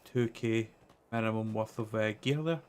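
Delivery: usual speaking voice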